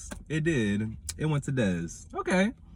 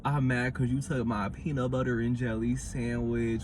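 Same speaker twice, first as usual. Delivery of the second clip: mock petty whine; 5 semitones lower, 5 dB quieter than usual. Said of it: "It's giving".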